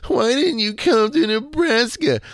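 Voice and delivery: high, loud, slow whine; whiny mock fan voice. Shown as a demonstration. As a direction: whine it like a crying baby. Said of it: "this is how I read it".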